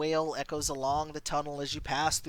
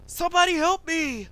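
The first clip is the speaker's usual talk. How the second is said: high, loud desperate shout; cry for help; 11 semitones higher, 6 dB louder than usual. Acted. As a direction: call out for rescue. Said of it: "you hear a shout".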